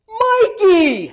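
high, loud laughing shout of a name; loud call across the store. Retold or imitated. Loud coach spotting him across the store, shouting. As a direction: call out Mikey across Sam's Club. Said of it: "this coach that is so loud".

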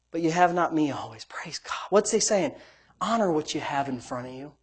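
hushed, near-whispered praise; reverent hush. Real, not acted. Preacher savors Jesus' words in a hushed whisper.

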